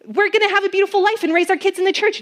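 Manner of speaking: brushing the doubt aside, a bit rushed; eager insisting. Retold or imitated. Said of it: "And it'd be like".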